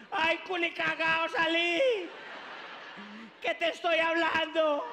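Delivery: loud, slow exasperated scolding; scolding mother. Retold or imitated. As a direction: yell at the baby to come out.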